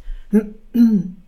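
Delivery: raven clears his throat; a gruff clearing of the throat. A narrator's reading. Raven clears his throat before speaking up. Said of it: "Er räusperte sich".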